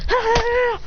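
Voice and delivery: high-pitched battle yell; fight cry. Acted. Fighters in an all-out fight, high battle cry. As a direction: let out a high "aah" of combat.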